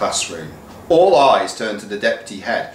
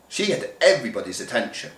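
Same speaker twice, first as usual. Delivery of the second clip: ordinary delivery, nothing marked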